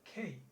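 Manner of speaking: খেই with a puff after k; aspirated K. Shown as a demonstration. Teacher repeating corrected K, aspirated with falling pitch. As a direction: repeat খেই, breathy k gliding to i. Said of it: "কি উচ্চারণ?".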